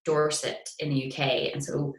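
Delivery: ordinary talk, nothing marked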